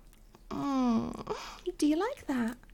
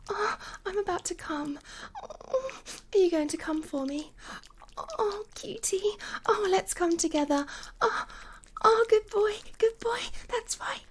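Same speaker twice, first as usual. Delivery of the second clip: high, urgent breathy voice; mounting acted arousal; breathless excitement; 8 semitones higher, faster than usual. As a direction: gasp the words out, pitch climbing. Acted climax of intimate roleplay.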